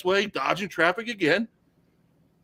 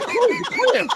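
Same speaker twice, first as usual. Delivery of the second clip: very high, squealed disbelief; mock incredulous; 3 dB louder than usual. Storyteller hears who the other woman is, high-pitched mock shock.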